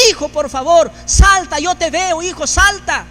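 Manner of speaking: loud, high urgent call across the fire; father shouts to son trapped on burning roof, urgent; father shouting to son. Acted. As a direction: yell up to the rooftop, pleading. Father calls to son through fire.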